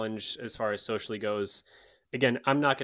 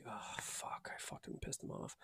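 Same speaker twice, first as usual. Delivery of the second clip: whispered mock regret; hushed, remorseful aside; guilty whisper; 17 dB quieter than usual. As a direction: whisper it to yourself as if you've hurt someone. Acts guilty aside after upsetting Clippy.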